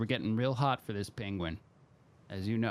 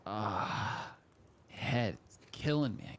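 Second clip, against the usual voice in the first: acted headache groan; much slower than usual. From headaches every day.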